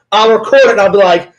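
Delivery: ordinary talk, nothing marked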